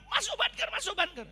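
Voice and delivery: high, loud repeated warning; urgent announcement. Retold or imitated. Pastor relaying the announcement sending everyone into bunkers, urgent high call. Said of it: "Terus ada pengumuman".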